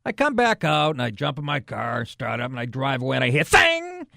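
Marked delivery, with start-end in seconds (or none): 3.4-4.1 sudden loud high bang noise; imitated crash noise. Man telling his gas station mishap, imitated crash. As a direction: blurt out the crash, much higher.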